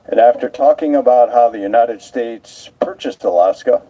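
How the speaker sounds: usual speaking voice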